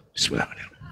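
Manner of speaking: whispered mock incantation. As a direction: breathe the prayer unvoiced.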